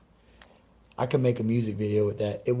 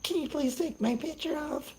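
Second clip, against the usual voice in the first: very high, soft mocking whine; squeaky mock plea from the bee; mocking whiny voice; 4 dB quieter than usual. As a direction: parody her plea in a tiny falsetto. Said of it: "that bee said".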